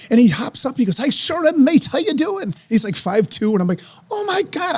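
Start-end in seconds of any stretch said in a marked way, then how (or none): 1.0-2.5 mock Aussie-ish greeting up high. Retelling [name] greeting him. 4.1-4.8 squeaky high awed gasp; starstruck. Retold or imitated. Starstruck reaction retold.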